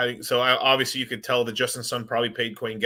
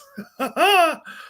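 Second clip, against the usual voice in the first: high amused laugh; 5 dB louder than usual. Real, not acted. Laughs after the clip.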